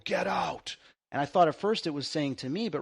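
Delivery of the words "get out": demonic voice. Retold or imitated. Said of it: "you hear this demonic voice go".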